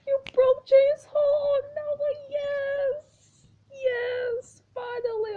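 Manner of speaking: very high, loud drawn-out cheer; ecstatic squeal. Real, not acted. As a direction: shriek with joy at winning. Celebrating victory.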